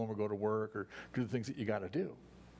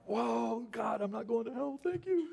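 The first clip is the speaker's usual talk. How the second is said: overjoyed relief; 12 semitones higher, slower than usual. Acted. Pastor acting a saved man thanking God, high and elated. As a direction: burst out in giddy relief. Acted joy at salvation.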